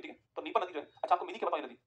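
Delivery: ordinary delivery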